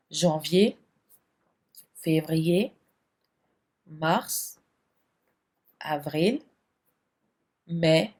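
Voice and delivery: slow list of months, each with a rising tune; drill recitation. Shown as a demonstration. Teacher recites the French months for students to repeat.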